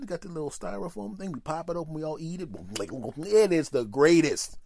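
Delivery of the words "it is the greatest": slow, louder, savoring every word; savoring rave. Acted. Praising the truck food to friends, slow and loud. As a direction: drag it out like the best thing you ever tasted.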